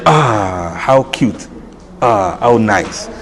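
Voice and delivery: hushed, slow admiring coo; soft cooing. Retold or imitated. Admiring a little boy leading prayer, hushed slow coo. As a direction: coo softly like at a sweet child.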